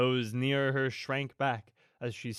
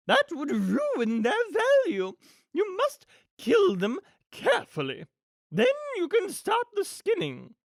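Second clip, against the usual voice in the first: high, swooping grande-dame villain voice; loud, theatrical and callous schemer; imperious villainess; 5 dB louder than usual. Acted. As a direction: play a haughty villainess, pitched way up. Acts the villainess Cruella.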